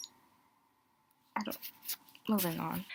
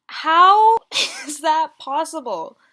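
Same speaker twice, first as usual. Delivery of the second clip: laughing disbelief; 7 semitones higher, 12 dB louder, slower than usual. Real, not acted. Laughing at insult "you look boring.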